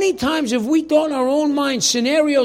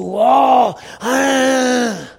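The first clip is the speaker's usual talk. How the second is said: loud, drawn-out mock cry of panic; worked-up wail; 5 dB louder than usual. Preacher mimicking people getting all worked up, worked-up wail. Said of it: "You get all worked up".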